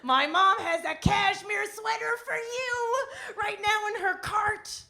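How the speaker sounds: high, loud and drawn out; excited husband. Retold or imitated. Husband announcing his mom's gift to his wife, thrilled. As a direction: stretch every word in high, squealed delight. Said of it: "he's getting excited".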